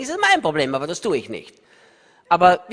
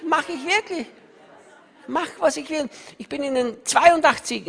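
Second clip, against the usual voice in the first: normal talking voice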